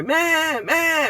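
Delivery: high, loud "meh" like a goat; mock goat yelling; loud goat bleat. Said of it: "really loud".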